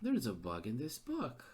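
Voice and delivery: soft, resigned aside; muttered repeat. Real, not acted. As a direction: mutter "There's a bug in this book." in disbelief. Repeats it under his breath.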